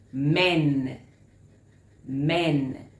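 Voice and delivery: MEN held long and loud on the vowel; MEN stretched out for learners to hear; overemphasized short E. Shown as a demonstration. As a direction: lean hard on the "eh" in MEN. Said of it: "don't be afraid to overemphasize".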